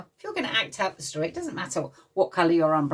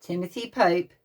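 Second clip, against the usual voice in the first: ordinary delivery, nothing marked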